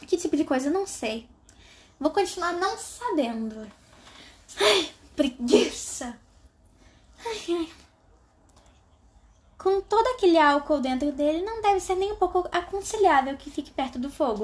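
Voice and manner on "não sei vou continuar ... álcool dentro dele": ordinary delivery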